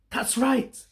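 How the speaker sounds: plain reading voice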